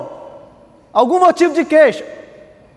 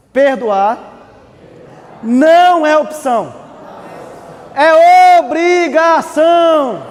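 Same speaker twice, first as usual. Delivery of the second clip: slow, building to a shout; emphatic shout; 4 semitones higher, 4 dB louder, much slower than usual. Shown as a demonstration. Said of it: "Vou repetir".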